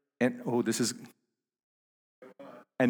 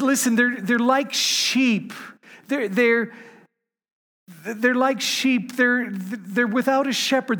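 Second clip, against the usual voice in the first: high, loud preacher's build; impassioned preaching; 10 semitones higher, 4 dB louder than usual. Acted. Preaching at full force with the voice lifted high. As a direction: pastor pleading for the lost sheep, preaching at full force.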